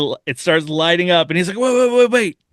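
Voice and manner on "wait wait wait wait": much higher and louder, frantic to stop her leaving; urgent calling out. Retold or imitated. Demon calling after the departing angel, urgent loud plea. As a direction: shout wait before she vanishes.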